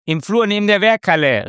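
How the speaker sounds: high, loud, fast call; the apprentice shouts the answer, fast and loud; shouting. Acted. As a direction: blurt it out across the hall. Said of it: "rief schließlich Mehmet".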